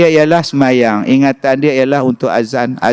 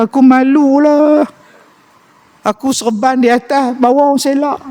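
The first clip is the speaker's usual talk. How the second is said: whining husband; 10 semitones higher than usual. Retold or imitated. A husband whining to his wife about his shame. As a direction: whine like a humiliated man close to tears. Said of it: "dia teriak".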